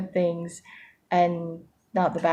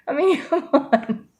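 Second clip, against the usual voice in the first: higher, slow, through a laugh; 9 semitones higher, 3 dB louder than usual. Real, not acted. She laughs to viewers about lifelong journaling, higher and slow. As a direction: laugh at how obvious it is. Laughing at her own point.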